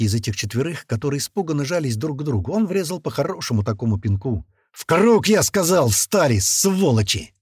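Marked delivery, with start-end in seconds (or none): 4.7-7.3 loud, raised-pitch angry order; furious repeated order; angry command. A narrator's reading. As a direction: bark at them to obey. Gunman repeats his barked order angrily.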